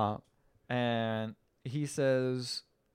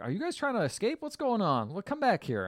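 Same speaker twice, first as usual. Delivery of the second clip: mock cop call; 8 semitones higher than usual. Cop calling them back.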